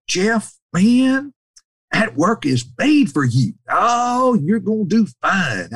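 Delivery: high, hearty encouragement; high-pitched, drawn-out reassurance; Brother Holland. Retold or imitated. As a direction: imitate an older mentor cheering someone on. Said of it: "I could have heard him".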